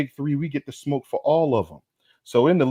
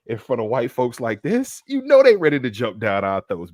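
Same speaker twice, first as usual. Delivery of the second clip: ordinary delivery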